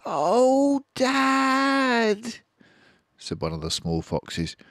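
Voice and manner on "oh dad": high pleading little voice; little fox cub. Acted. Small fox to Dad, high pleading. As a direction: plead like a hungry child.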